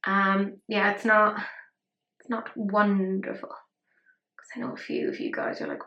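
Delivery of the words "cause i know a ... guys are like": sudden confiding whisper. Real, not acted. Whispering to her viewers about purple fans. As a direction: lean in and whisper it to them.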